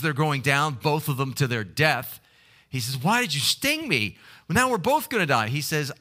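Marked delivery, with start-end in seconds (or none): 3.0-5.5 higher, rising panicked question; alarmed frog. Acted. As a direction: cry out in betrayed alarm as you go under. Frog stung while sinking.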